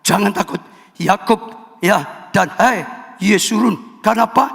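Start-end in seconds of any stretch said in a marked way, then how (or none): none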